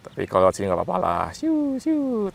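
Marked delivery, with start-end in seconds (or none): 1.4-2.3 excited cheer. Real, not acted. Celebrating a drone trick.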